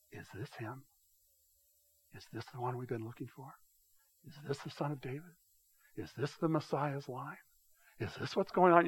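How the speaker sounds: excitement under breath. Retold or imitated. Pastor voices a hopeful onlooker, hushed excitement. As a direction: breathe the hope quietly, barely voiced. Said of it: "an excitement under their breath".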